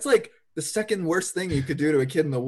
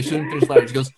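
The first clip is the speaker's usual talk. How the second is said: usual speaking voice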